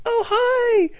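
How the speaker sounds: mock saccharine hello; sickly sweet greeting. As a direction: gush it like a too-perfect agent. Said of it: "like this".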